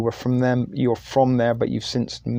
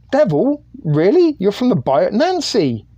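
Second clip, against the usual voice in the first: high, loud disbelieving questions; surprised; 8 semitones higher, 5 dB louder than usual. Real, not acted. Learning Nancy is from the Bayou, high loud surprise. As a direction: exclaim it high, truly taken aback. Reacting to where chatters are from.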